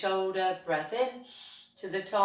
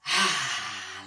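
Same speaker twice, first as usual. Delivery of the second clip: a lower open ah, louder; released ah; 9 semitones lower, 4 dB louder than usual. Shown as a demonstration. Teacher leading the class's breath release, with a lower open ah. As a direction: drop the breath out on ah. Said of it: "As tall as you can go and".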